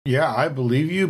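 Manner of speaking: ordinary delivery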